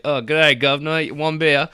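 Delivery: put-on jokey Cockney-ish British accent; mock British accent. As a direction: play a cheesy British bartender. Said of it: "a beard and a British accent".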